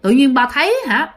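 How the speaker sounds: plain reading voice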